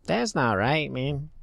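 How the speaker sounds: lightly hammed Mexican accent; mock Mexican accent. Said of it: "Maybe they're Mexican".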